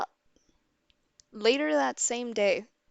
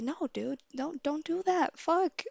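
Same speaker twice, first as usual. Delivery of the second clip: higher-pitched exasperated plea; retold dismay; 7 semitones higher than usual. Reacting to kids idolizing the culprit, retold exasperation. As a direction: plead with them, mildly acted.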